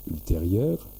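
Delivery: ordinary delivery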